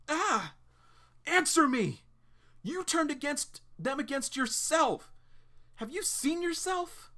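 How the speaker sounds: high, loud furious yell, slowing at the end. Acted. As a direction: scream it into her face, high and hard. John furious at Claire.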